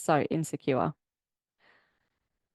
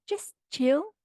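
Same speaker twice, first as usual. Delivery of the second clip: higher mock dude voice, rising; mock chill; 9 semitones higher than usual. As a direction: tease him telling her to relax. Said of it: "He's like".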